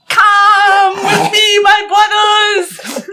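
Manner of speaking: high, loud, very slow heroic call; grand shout. Acted. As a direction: call to the brothers like a descending god. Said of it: "and he says".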